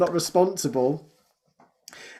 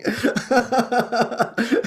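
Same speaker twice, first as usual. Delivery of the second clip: high giggle; 7 semitones higher than usual. Real, not acted. Laughing at own joke.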